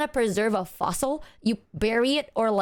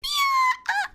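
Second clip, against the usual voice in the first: a sudden, much higher yelp of delight; excited shriek. Real, not acted. Reacting to the co-host's joke with a delighted high yelp. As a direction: jump in with a high, gleeful Yeah.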